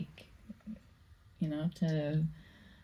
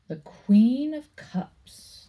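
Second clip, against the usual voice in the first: slow, louder, higher "the Queen of Cups"; dramatic reveal; 7 semitones higher, 8 dB louder, much slower than usual. Real, not acted. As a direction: unveil the card with flair.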